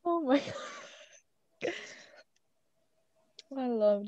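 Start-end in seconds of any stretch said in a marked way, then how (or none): none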